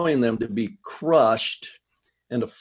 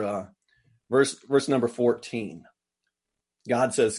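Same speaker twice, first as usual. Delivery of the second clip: normal talking voice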